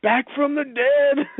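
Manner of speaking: high, drawn-out dramatic cry; mock-dramatic quote. Quoting the Robot Chicken Boba Fett parody, mock dramatic. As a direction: proclaim it slowly, hammed up. Mimicking a parody episode line.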